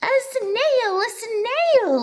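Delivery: very high, loud alarmed cry; shocked shriek. Acted. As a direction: squeal as if a mouse ran by. Teacher shrieks at snail.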